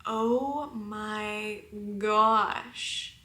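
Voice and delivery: slow, drawn-out oh my gosh; awestruck. Retold or imitated. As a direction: stretch each word in stunned wonder. Awed reaction to the woman's words.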